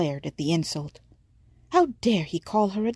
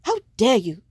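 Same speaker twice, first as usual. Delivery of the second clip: loud, higher outraged shout; 5 semitones higher, 5 dB louder than usual. Acted. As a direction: hurl it back at him in fury. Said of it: "she shouted".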